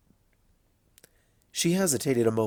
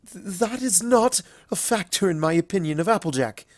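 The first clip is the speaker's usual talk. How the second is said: stammered reply; 7 semitones higher than usual. A narrator's reading. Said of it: "mustering a stammered reply".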